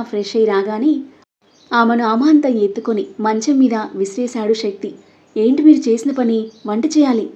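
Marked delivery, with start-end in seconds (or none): none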